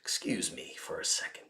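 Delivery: real hushed self-calming aside; whisper. Real, not acted. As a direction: breathe it under your breath.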